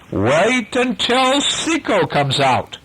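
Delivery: slow, high growled protest. A narrator's reading. Starkey balking at the captain's demand, growled. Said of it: "growled Starkey".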